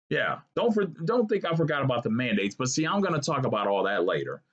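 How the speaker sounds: usual speaking voice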